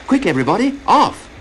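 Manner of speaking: high, loud alarm call; rising urgent cry of warning; urgent shout. Acted. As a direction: hurry everyone off before the tide. Tide coming in, urgent warning.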